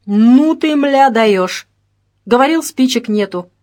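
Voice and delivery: astonished. A narrator's reading. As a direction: exclaim it, caught by surprise. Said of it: "Мужчина удивлённо уставился на него". (с громким удивлением)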